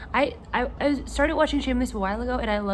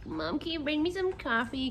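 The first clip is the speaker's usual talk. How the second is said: annoying pleading; 7 semitones higher, 6 dB quieter than usual. Pretend. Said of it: "be annoying to my mom".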